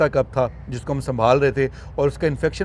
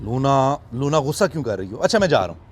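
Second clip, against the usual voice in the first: ordinary talk, nothing marked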